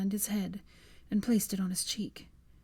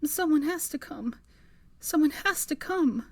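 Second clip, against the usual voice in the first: desperate; 8 semitones higher than usual. Acted. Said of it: "her lips quivering".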